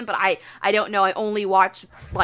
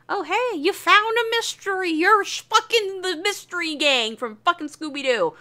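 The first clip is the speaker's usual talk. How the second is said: mocking voice; 10 semitones higher than usual. Mocking anime mystery cliché.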